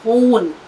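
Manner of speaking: खून said as ख़ून with a throaty ख़. Shown as a demonstration. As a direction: make the ख़ in खून scrape in the throat. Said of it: "ख इस्तेमाल करें तो उसके नीचे बिंदी". (ख़ून में ख़ की सही गहरी आवाज़)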